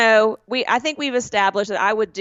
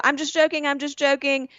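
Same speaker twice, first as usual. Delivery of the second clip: high, hurried I'm just joking; anxiously taking it back; nervous backpedal; 6 semitones higher than usual. Retold or imitated. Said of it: "so I go".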